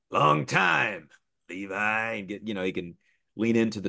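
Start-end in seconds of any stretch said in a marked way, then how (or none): 0.1-2.1 drawn-out Texas twang, voice swooping up; Western twang. Acted. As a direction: lean into a slow Western cowboy drawl. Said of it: "kind of a Western vibe to him".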